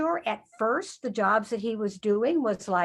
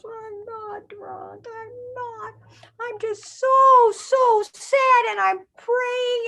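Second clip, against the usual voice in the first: pleading Hannah; 11 semitones higher, slower than usual. Acted. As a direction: begin hushed, then cry it out high and slow. Hannah answers Eli, tearful.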